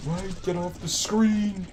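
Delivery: high, slow frustrated yell; shouting. Real, not acted. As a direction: yell it at the screen. Yelling at something on screen.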